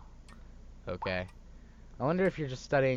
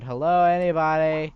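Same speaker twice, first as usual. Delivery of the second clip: calling out; 4 semitones higher, 9 dB louder than usual. Retold or imitated. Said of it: "You yelled out".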